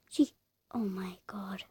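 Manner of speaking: unvoiced whisper; hushed shocked aside. Real, not acted. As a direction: whisper it so she won't hear. Aside about rude player.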